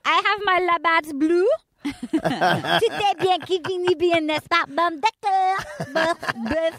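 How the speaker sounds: badly faked French Canadian, played for laughs; fake French Canadian accent. Host mocking French Canadian speech, hammed-up French Canadian accent. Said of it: "it's French Canadian".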